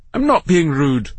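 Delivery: high, indignant protest; seething. A narrator's reading. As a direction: seethe as you deny it. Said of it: "seething now".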